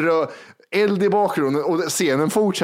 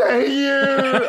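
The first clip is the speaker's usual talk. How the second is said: high, overdone mock "Nej!" shriek; retold shriek; 10 semitones higher than usual. Imitating the overacting actress's screamed no. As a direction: ham up a hysterical actress screaming no. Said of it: "Hon skriker".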